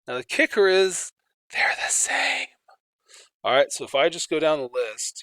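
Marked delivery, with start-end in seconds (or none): 1.5-2.5 secretive whisper of a reveal. Acted. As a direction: lean in and whisper it. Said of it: "whispered reveal".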